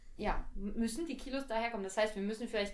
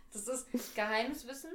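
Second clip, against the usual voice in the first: ordinary delivery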